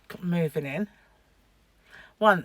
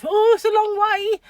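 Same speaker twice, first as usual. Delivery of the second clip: high, loud little mouse voice; comic high-pitched mock dismay; cartoon mouse; 13 semitones higher, 9 dB louder than usual. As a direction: squeal it like a daunted cartoon mouse. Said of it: "one of those cartoons where the little mouse".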